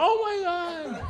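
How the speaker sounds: high, slow, swooning "Oh my God"; mock starstruck crowd; breathy-sounding awe, drawn out high; awed gasp. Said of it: "everyone will be like".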